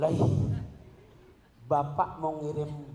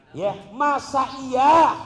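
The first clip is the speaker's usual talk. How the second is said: loud rhetorical challenge; 9 semitones higher, 11 dB louder, much slower than usual. Real, not acted. Preacher challenging the congregation.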